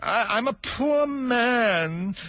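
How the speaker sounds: high, slow stammering plea; timid hatter begging pardon; nervous hatter. Acted. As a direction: stammer it out, afraid of the king. Hatter pleads again.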